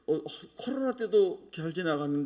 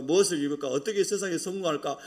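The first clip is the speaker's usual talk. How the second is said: ordinary delivery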